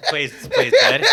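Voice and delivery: high, laughing voice; talking through a laughing fit. Real, not acted. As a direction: squeeze the words out through laughter. Cracking up at dog story.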